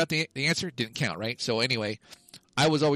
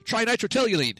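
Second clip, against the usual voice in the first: blurted high and sure of himself; said with conviction; 10 semitones higher than usual. Retold or imitated. Nerdy kid buzzing in with total certainty. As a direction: fire the answer out like a know-it-all. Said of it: "'cause I said it with such conviction".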